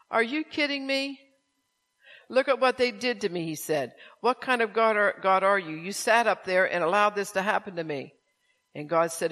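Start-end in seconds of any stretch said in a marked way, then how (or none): 0.1-3.5 high, loud disbelief; indignant protest. Acted. Tony protesting to God. 4.2-8.2 loud, raised accusing voice; angry accusation. Acted. Tony accusing God.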